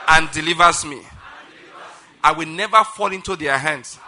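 loud, measured proclamation with pauses; preaching declaration. Acted. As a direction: declare it line by line for a congregation to repeat. Leads congregation in declaration.

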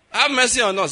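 high, loud cry for mercy; pleading call. Acted. Lepers appealing to Jesus, high loud acted call.

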